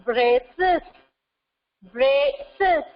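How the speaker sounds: "braces" said as brace, then a separate es; teacher's syllable-by-syllable "braces"; split into two syllables. Shown as a demonstration. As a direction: sound out "braces" in two distinct beats. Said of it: "Two syllable".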